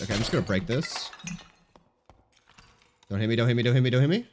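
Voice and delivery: usual speaking voice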